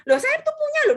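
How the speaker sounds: high-pitched, puzzled objection; acted-out indignant confusion; baffled protest. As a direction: protest as if wrongly accused. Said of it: "bingung saya".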